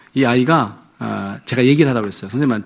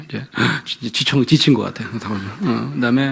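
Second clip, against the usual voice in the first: normal talking voice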